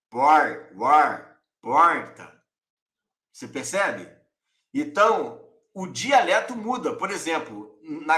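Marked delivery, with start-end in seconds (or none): 0.1-2.4 "porta" with a curled, drawn-out caipira R; caipira-style "Por, war, porta" with the R curled back; São Paulo countryside accent. Shown as a demonstration. A teacher showing the interior São Paulo accent in "porta". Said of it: "Eles vão falar porta".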